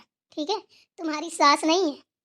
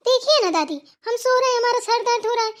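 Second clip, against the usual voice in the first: high, raised-voice appeal to grandma; indignant tattling to an elder, loud and high; loud complaint; 6 semitones higher, 4 dB louder than usual. Acted. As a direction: complain loudly, pitched well up. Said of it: "तुम इस तरीके से चिल्ला रही हो".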